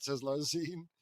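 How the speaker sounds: ordinary talk, nothing marked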